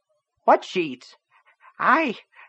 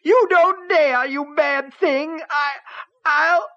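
much higher, slow, crying rage; tearful wail; 7 dB louder, slower than usual. A narrator's reading. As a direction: wail through tears. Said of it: "Tears were running down her cheeks now".

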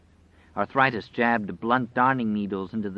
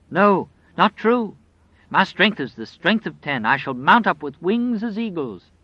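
defiant cry; 8 semitones higher, 6 dB louder than usual. Acted. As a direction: defy him with loud faith. Old woman defying the wolf.

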